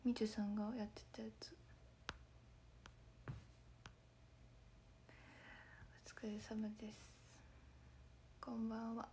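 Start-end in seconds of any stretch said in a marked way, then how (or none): 0.0-7.0 whispered through a worn-out voice; real strained whisper after shows; hoarse voice. Real, not acted. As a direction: spare a hoarse throat with a whisper. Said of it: "声ガサガサすぎる".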